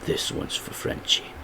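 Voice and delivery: whispered vow; very soft, voiceless payback line; Butcher. Acted. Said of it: "and he says".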